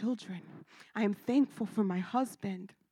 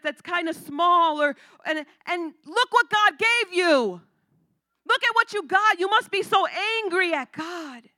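higher, louder imitation of a worked-up relative; pitying relative; 5 semitones higher, 6 dB louder than usual. Relative imagining her pity: higher, louder rant. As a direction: mimic her pressing you to be angry.

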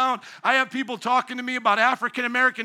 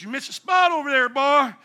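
a bossy deacon in a put-on Southern accent; 3 semitones higher, 4 dB louder than usual. Preacher mocking a new deacon with a Southern accent. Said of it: "they start talking like this".

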